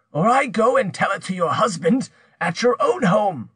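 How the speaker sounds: high-pitched, forceful threat; threatening accuser. Acted. Pierre threatens to tell her husband, menacing.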